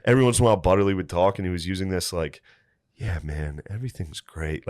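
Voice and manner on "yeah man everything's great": low, subdued monotone; monotone drone. Retold or imitated. Butterfly-tripper friend soothing the narrator in a monotone. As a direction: drone it softly, all on one level. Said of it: "this real monotone thing".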